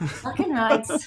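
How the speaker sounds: mock Canadian swear. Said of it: "the most Canadian swear".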